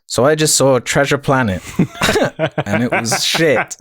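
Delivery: laughing mock impression of the co-host. Mimicking his co-host's voice, laughing. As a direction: break into giggles while imitating him. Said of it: "Both of you do an impression of the other".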